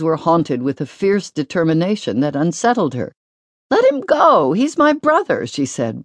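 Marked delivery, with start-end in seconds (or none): 3.7-5.5 put-on high girl's voice, pleading; a blushing girl begging for her brother; teen girl protecting her little brother, high and urgent; Susanna, flustered. A narrator's reading.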